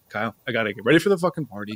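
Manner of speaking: ordinary delivery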